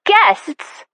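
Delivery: high, scornful disbelief; incredulous echo. Acted. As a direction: toss the word back in disbelief. Mertensia whirls round, incredulous.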